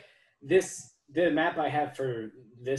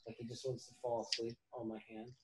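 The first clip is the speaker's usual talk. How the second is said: usual speaking voice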